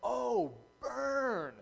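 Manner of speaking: playful mock gasp at an insult; teasing burn. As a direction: crow over a put-down, boy style. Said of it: "one of my boys said".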